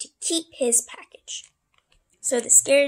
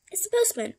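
high, singsong postman announcement; high-voiced postman; 9 semitones higher than usual. Postman repeats who he is, in a high voice. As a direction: call it out in a much higher voice. Same answer redone much higher.

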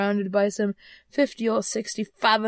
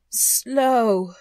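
indignant ghost echo; 3 semitones higher, 7 dB louder than usual. A narrator's reading. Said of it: "the ghost repeated".

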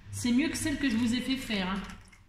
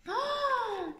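very high drawn-out oh; 14 semitones higher than usual. Real, not acted. Smelling the gift candles on camera, a high delighted oh. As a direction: let a high oh of delight float out.

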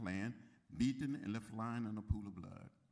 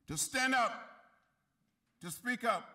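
rousing shout; 10 semitones higher, 8 dB louder than usual. Real, not acted. Rally call to action.

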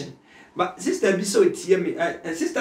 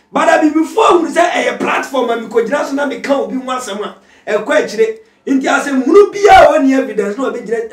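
loud, high preaching shout; preaching at full force; 9 semitones higher, 11 dB louder than usual. Acted. Pastor exhorts listeners about social media, full-force preaching. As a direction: pastor building to a fervent shout.

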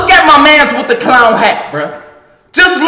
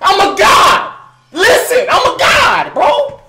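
high triumphant shouting; excited yelling; 7 semitones higher, slower than usual. Real, not acted. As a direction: scream in victory. Celebrating a play.

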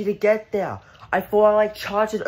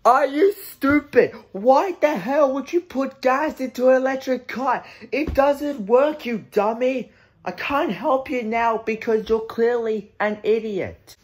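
incredulous scolding; 5 semitones higher than usual. Acted. Bystander to man putting gas into electric car, incredulous scolding. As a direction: berate them as a total idiot. Bystander berating the Tesla owner.

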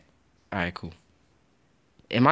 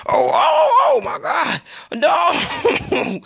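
much higher, louder anguished yell; shocked shout; 5 dB louder, much slower than usual. Real, not acted. Gamer watching his escape fail, high anguished shout. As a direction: scream it as everything goes wrong. Disaster happens during escape.

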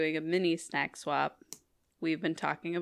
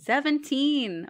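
drawn-out, high amazed "seventeen"; 8 semitones higher, much slower than usual. Real, not acted. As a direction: stretch it out in disbelief. Counting the episode, amazed.

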